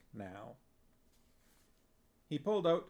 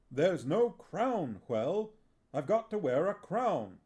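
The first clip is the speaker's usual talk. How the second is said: insistent actor; 6 semitones higher, 6 dB louder than usual. Acted.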